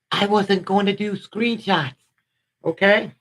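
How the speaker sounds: ordinary talk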